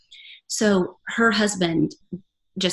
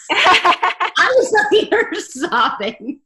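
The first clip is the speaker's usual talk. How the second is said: high, slow words through real laughter; laughing at herself; 10 semitones higher, 3 dB louder, much slower than usual. Real, not acted. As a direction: laugh through it at your own blunder.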